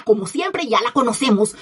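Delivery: ordinary talk, nothing marked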